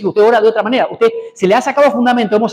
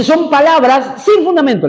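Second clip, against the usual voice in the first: higher, drawn-out mock taunt; intimidating taunt; 6 semitones higher, slower than usual. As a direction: quote the enemy, slowly stretching the put-down. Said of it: "Fíjese cómo lo quiere amedrentar".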